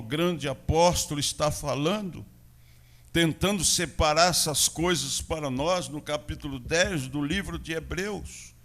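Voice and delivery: normal talking voice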